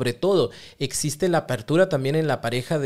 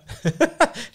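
high laugh; 14 semitones higher, 3 dB louder than usual. Real, not acted. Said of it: "¿No está el PlayStation escondido?".

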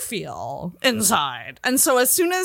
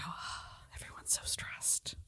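breathy mock whisper of the crowd; 23 dB quieter than usual. At Walmart, whispering what the anxious crowd says. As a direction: hush it like gossip in the aisle. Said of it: "and I just go".

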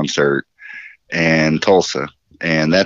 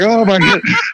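much higher, hammed-up gasp; mock exclamation. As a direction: squeal it high in mock shock. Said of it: "he's like".

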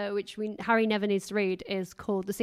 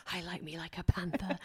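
low, softer diva voice; 4 semitones lower, 6 dB quieter than usual. Retold or imitated. Imagining star in makeup chair, low hushed imitation. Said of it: "[name] sitting down and being like".

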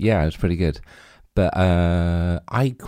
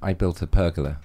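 ordinary delivery

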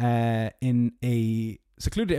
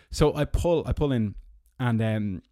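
ordinary delivery

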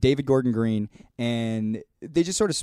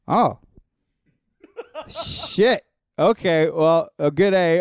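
embarrassed realization; 6 semitones higher, 5 dB louder, much slower than usual. Retold or imitated. Drunk caller realizes mistake.

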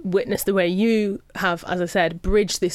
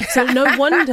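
laughing; 6 semitones higher, 6 dB louder than usual. Real, not acted. Laughing at herself.

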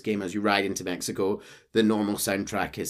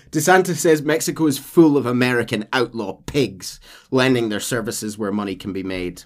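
ordinary talk

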